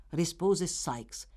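ordinary delivery

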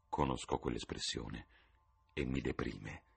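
weary low murmur. Acted. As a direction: mutter it down into the chest. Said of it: "mormorò Lord Henry".

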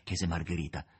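ordinary delivery, nothing marked

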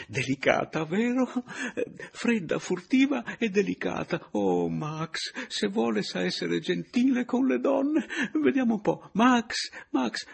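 high, excited, coaxing voice; eager old man. A narrator's reading. The old host eagerly coaxes the spirit Max. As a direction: coax the spirit like a delighted child.

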